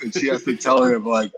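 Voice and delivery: usual speaking voice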